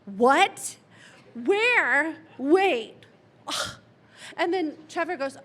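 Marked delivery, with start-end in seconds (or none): 0.1-3.8 louder, mock upset outburst; mildly hammed-up upset friend. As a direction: play his indignant confusion. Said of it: "and he's all upset".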